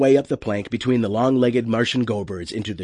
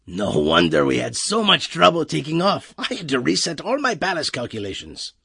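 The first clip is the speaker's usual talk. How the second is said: higher-pitched put-on voice, complaining; aggrieved crewman, grumbling; 5 semitones higher than usual. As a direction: grumble as the put-upon navigator.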